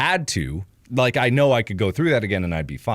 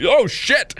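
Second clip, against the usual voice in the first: high mock alarm; alarmed curse; 13 semitones higher, 4 dB louder than usual. Doctor seeing the burn, high alarmed curse. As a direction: exclaim it in shock.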